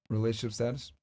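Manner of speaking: ordinary talk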